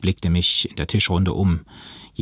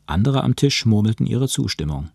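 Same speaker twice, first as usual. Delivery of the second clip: plain reading voice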